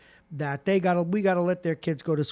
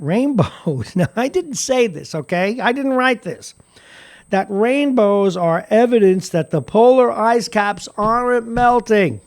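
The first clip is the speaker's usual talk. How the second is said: incredulous; 6 semitones higher, 11 dB louder than usual. Real, not acted. Incredulous, laughing at quote.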